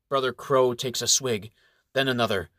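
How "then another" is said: plain reading voice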